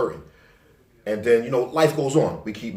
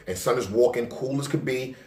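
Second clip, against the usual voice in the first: ordinary talk, nothing marked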